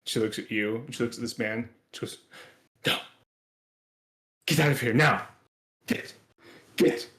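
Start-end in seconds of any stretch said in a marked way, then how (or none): 2.9-7.1 angry order. Acted. NPC orders stranger out.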